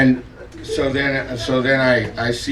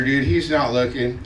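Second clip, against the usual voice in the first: ordinary delivery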